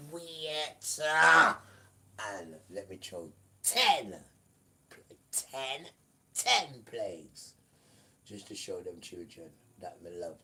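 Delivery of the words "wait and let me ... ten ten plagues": loud, slow proclamation; loud preaching. Acted. Preacher proclaiming the ten plagues, loud and slow. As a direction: draw the words out, booming.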